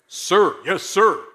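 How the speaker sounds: loud barked reply to sergeant; shouting. Pretend. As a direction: shout it like a recruit. Recruit answers drill sergeant.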